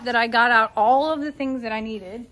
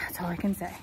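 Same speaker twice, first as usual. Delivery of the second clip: whispered aside, no voice; 6 semitones lower, 10 dB quieter, much faster than usual. Real, not acted. Whispering to the camera about the Listerine soak. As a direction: breathe it out as a worried aside. Aside after Lord help us.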